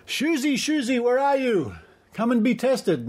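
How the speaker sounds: high singsong call, mocking. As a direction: call out teasingly for someone hiding. Mock calling out to Shuzi.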